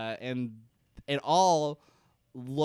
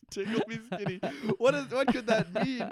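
high voice breaking into laughter; incredulous laughter; 7 semitones higher than usual. Real, not acted. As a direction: repeat it while cracking up. Cracking up at co-host's phrase.

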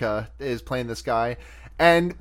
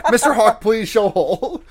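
laughing quote; 6 semitones higher, 6 dB louder than usual. Retold or imitated. Quoting the deputy, laughing.

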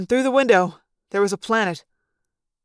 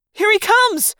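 high-pitched urgent cry; sharp jump up into a warning shout; warning yell; 12 semitones higher, 4 dB louder, slower than usual. A narrator's reading. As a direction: yell out an alarm to the crew. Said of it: "Rachel yelled in warning".

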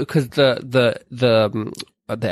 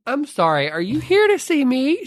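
high, slow puzzled question; confused Warner; 12 semitones higher than usual. Retold or imitated. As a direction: ask it with mock disbelief.

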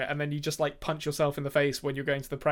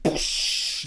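explosive hissing whoosh; blood-spurt noise. Retold or imitated. Host mimics blood spurting from a stabbed samurai, whooshing noise. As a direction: imitate pressurised blood bursting out. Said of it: "geyser of blood that flies out".

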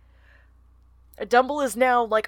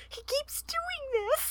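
very high, drawn-out, soft squeal; fond exasperated squeal; 8 dB quieter, slower than usual. Real, not acted. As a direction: squeeze it out high, overcome by cuteness. Gushing over the character's friendliness.

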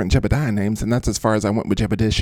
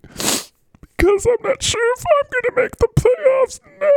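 hammed-up falsetto wail; crying character. Mister Golf fears missing the playoffs, wailing in tears. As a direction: bawl it out, very high. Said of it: "why are you crying".

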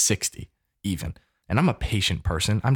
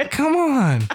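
high, loud come on; a sudden loud, high plea to his sister; exasperated outcry; 4 dB louder than usual. Real, not acted. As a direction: throw it out in exasperation. Exasperated call to co-host.

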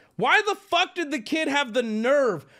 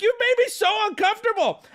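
much higher, mock wounded; high whiny complaint; 12 semitones higher than usual. Customer telling the worker he felt awkward, high pitched. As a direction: whine it out, half joking. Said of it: "employees heard me yelling".

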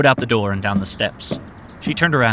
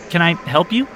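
cheerful clerk; 8 semitones higher than usual. Acted. Counter clerk greeting.

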